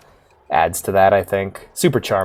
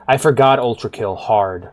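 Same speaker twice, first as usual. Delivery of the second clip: usual speaking voice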